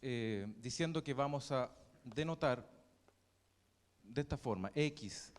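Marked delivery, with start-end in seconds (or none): none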